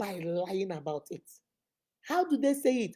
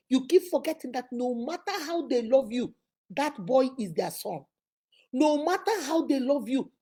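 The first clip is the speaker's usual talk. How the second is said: ordinary talk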